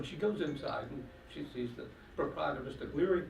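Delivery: normal talking voice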